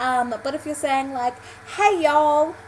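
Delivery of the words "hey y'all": American y'all accent. Shown as a demonstration. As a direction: call out a folksy American hello. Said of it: "can't have the same accent".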